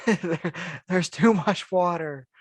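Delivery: high, slow, drawn-out complaint; hammed-up voice, high and slow; playful sun voice. The sun objecting to Earth's oceans, playful high voice. Acts the sun, amid laughter.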